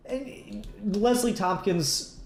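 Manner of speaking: ordinary delivery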